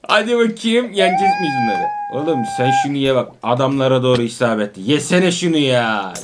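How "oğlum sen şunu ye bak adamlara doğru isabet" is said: ordinary talk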